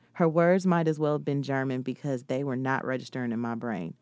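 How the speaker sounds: plain reading voice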